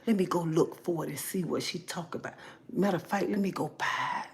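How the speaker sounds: soft, secretive aside; furtive gossip. Acted. Gossiping viewer secretly going to look it up, hushed aside.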